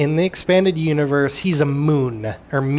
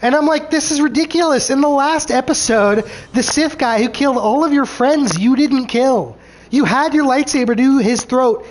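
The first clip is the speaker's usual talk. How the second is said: exasperated rant; 7 semitones higher than usual. Real, not acted. Reviewer venting at the show's logic, high exasperated rant. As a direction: pitch climbs as the disbelief boils over. Viewer ranting at the plot.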